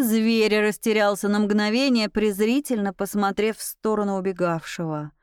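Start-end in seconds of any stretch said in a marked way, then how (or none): none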